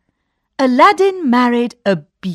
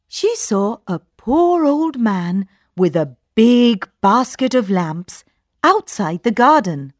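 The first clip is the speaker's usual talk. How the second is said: usual speaking voice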